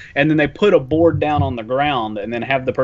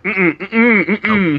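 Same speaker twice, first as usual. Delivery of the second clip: tight, nervous mm-mm's, slightly raised; scared humming refusal; 3 semitones higher, much slower than usual. Retold or imitated. Rob on the open skywalk refusing, nervous humming. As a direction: hum a frightened no. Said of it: "And Rob was like".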